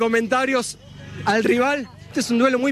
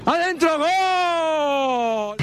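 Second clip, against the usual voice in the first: higher, drawn-out gol cry; goal shout; 5 semitones higher, much slower than usual. Acted. Commentator calling the shot in, long shouted goal cry. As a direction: belt the goal like a stadium.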